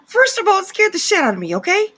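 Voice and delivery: high, loud shouting. Real, not acted. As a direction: rant loudly after a fright. Startled by a fake snore.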